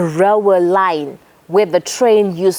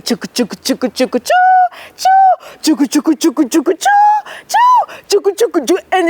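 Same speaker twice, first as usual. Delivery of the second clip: train rushing past; 10 semitones higher than usual. A narrator's reading. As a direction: let the train thunder over him. Train passing over boy.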